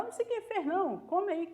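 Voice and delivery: quick high-pitched brush-off, softly; mock evasive reply; desconversando. Colega desconversa, em diálogo encenado. (resposta evasiva encenada, aguda e baixa)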